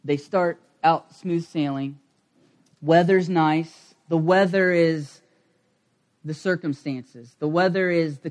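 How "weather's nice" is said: usual speaking voice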